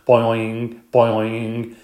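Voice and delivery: loud springy boings; boing noises. Acted. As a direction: bounce out two loud boings. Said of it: "we kept up the noise".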